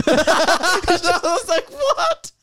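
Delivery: high, laughing "What?"; laughing hard. Real, not acted. Recalling the dad's teasing to his son, laughing. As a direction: crack up in disbelief.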